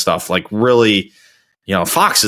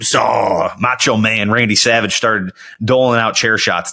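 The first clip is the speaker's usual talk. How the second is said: ordinary talk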